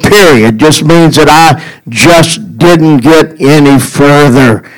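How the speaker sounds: usual speaking voice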